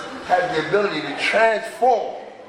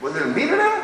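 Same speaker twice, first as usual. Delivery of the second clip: sponsor's question; 9 semitones higher than usual. Retold or imitated. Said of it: "He said".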